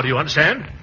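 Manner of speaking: ordinary talk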